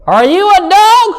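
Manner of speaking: high, slow mock child voice; kid's question. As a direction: ask the bushes with wide-eyed innocence. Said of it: "I was like".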